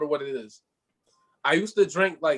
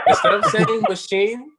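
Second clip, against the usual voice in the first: slow, laughing, louder retelling; 7 semitones higher, 7 dB louder, slower than usual. Shown as a demonstration. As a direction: laugh through it slowly. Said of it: "Say it one more time".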